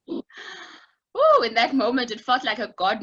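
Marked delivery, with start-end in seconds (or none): 0.1-1.4 emotional gasp. Real, not acted. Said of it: "I'm getting emotional just thinking about it".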